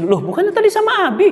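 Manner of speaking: high, quick startled question; the wife realising the child is missing. Retold or imitated. Wife asks where son is. (istri kaget, suara tinggi dan cepat)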